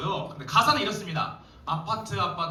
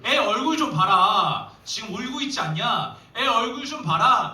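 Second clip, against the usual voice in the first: scolding shout; 4 semitones higher, 6 dB louder than usual. Retold or imitated. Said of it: "이렇게 말했다는 거죠".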